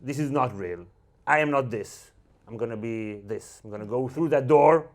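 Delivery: ordinary talk, nothing marked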